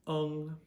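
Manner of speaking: UNG high, loud and level; first tone. Shown as a demonstration. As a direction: hold UNG on one high note, as in "lung". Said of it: "all you have to think is U N G".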